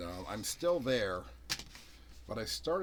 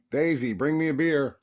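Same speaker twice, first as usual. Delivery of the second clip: loud, higher call to the dog; commanding a dog; 5 semitones higher, 11 dB louder than usual. Real, not acted. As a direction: call the command across the room.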